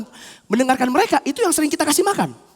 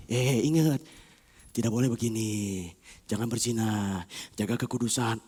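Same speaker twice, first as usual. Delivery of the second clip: very low, hushed admonition; soft, drawn-out inner-voice caution; gentle reminder; 10 dB quieter than usual. Acted. Spirit reminding the soul of holiness, hushed and low.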